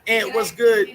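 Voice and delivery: loud welcome to a viewer; hearty shout-out. Real, not acted. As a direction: call out the name. Calling out to a viewer.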